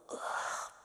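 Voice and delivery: drawn-out "ugh" of boredom; disgusted groan. Acted. Demon sick of career-minded demons, disgusted groan.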